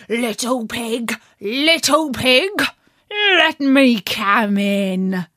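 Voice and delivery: slow, coaxing wolf; sweet-talking wolf at the door; big bad wolf voice. Wolf asks to enter.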